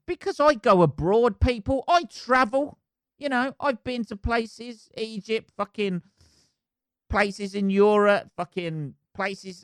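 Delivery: really fired up about it; worked-up rant. Real, not acted. Ranting to listeners that everyone speaks English, worked up.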